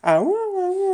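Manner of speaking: very high, loud double howl; playful repeated "ah-woo"; wolf pack howl. Retold or imitated. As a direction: imitate a wolf pack howling together. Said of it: "they're doing the wolf pack".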